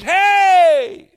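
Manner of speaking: high, loud call; boy greeting the cave; friendly shout. Acted. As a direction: call out brightly. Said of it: "He says".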